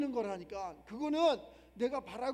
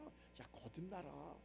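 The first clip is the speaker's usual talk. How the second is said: hushed command; 5 semitones lower, 10 dB quieter than usual. Retold or imitated. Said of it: "예수님 가르쳐 주잖아".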